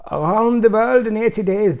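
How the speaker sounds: loud, high, hammed-up German accent; mock German accent. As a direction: belt the title out in a comic German accent. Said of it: "so much German accent stuff today".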